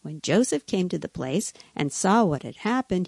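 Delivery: usual speaking voice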